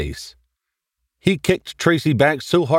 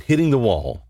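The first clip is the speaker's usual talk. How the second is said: ordinary delivery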